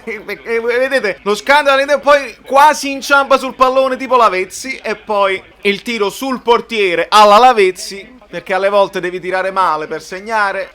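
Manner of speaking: usual speaking voice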